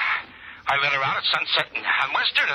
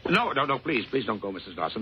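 usual speaking voice

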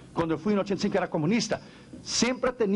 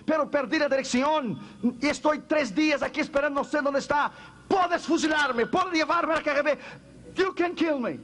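desperate bold confession; 8 semitones higher than usual. Acted. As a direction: throw it all out, ready to die. Pastor confesses boldly to KGB agent.

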